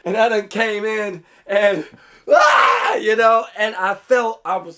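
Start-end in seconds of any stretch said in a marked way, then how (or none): 2.3-3.0 very high shouted cry; a sharp wounded "ah" as you hit bottom; yelp of pain. Retold or imitated. Kid dropping into the sand hole, high cry.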